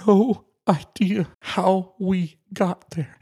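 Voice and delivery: holding back real tears; getting emotional. Real, not acted. Telling Nicole how God led them there, choked up. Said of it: "I did promise I'd get emotional".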